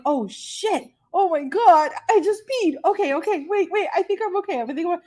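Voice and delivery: rising high, flustered panic; panicked. Retold or imitated. Caught peeing mid-swab, voicing her high flustered panic. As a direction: fluster through a sudden emergency.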